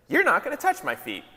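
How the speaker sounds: high-pitched mock protest; mildly hammed-up indignation; acted indignant refusal. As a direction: voice Peter's objection, pitched well up. Acting out Peter refusing Jesus.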